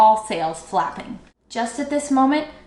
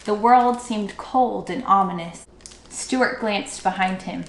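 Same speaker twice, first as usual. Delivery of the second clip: ordinary delivery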